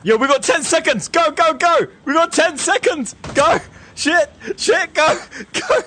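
urgent shouting. Real, not acted. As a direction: yell at the team to run. Timer running out.

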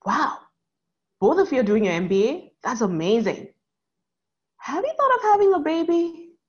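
lightly voiced chatty woman, rising high on the question; a touch of a curious older woman's gush; bright, nosy stranger on a plane; cheerful nosy passenger. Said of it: "super smiling".

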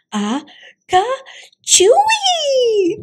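high, loud storybook sneeze; acted sneeze. A narrator's reading. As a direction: build up, then burst into a big sneeze. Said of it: "The kitty boy sneezed".

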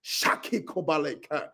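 low, soft tongues under the breath; speaking in tongues. Real, not acted. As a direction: pray in tongues, quietly.

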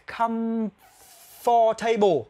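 very slow "comfortable" said as comf-table, the "for" dropped; slow teacher model of the silent syllable. Shown as a demonstration. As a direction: drag out "comfortable" without the for sound. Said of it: "for này là, là nó là âm câm".

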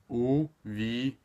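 "Увидимся" drawn out slowly in separate syllables; slow teaching take of "Увидимся". Shown as a demonstration. Teacher breaks word into syllables.

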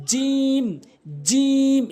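জিম with a long held "ee"; mid-tongue to palate, stretch জিম to "jeem"; correct জিম. Shown as a demonstration. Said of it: "এভাবে".

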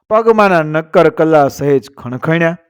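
usual speaking voice

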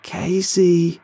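high, loud shout. A narrator's reading. Kyle shouting to Casey through the glass. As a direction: shout through the car window. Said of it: "Kyle shouts through the glass".

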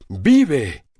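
a captain hearing his love lives; overjoyed shout. A narrator's reading. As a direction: shout it, mad with happiness. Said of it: "gritó Sandokan loco de alegría".

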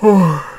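high, falling yawn; sleepy yawn sliding down. A narrator's reading. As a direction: let out a big yawn. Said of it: "Montague yawned".

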